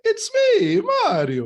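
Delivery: high, loud Italian plumber cheer; squeaky excited Mario catchphrase. Retold or imitated. Quoting Mario's famous catchphrase.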